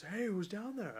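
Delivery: high, singsong calling-out voice; imitated call. Visitor calling down the stairs, imitated high call. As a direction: act out calling down to someone unseen.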